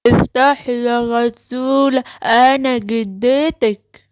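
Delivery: slow, drawn-out fake grandmother; odd, stretched-out granny voice; wolf posing as grandma. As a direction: coax slowly in a strange granny voice. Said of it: "وصوتك بقى عامل كده ليه يا جدتي؟".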